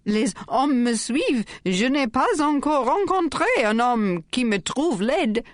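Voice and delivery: lightly voiced coy tipsy girl; tipsy girl in French. A narrator's reading. Sacha plays a tipsy girl.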